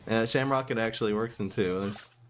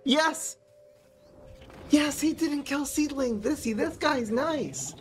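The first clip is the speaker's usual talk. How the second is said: excited cheer; 12 semitones higher, slower than usual. Real, not acted. Seedling survives, he cheers.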